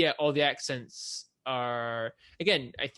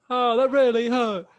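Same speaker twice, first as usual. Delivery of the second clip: high, loud, drawn-out mock complaint; mock hurt child; 10 semitones higher, 9 dB louder than usual. Quoting Charlie bit me, high mock-hurt child voice. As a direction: wail it slowly like a hurt little kid.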